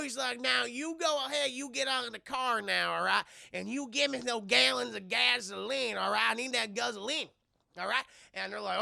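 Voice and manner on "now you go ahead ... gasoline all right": hammed-up hillbilly robber giving orders; Southern twang, pushing each demand; cowboy stranger. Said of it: "this guy in a cowboy hat".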